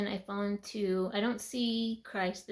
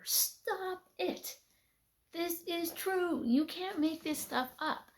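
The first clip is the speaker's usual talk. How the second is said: high, incredulous stop it; amazed; 9 semitones higher, slower than usual. Real, not acted. Showing viewers the statue, high incredulous disbelief. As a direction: marvel at it in disbelief.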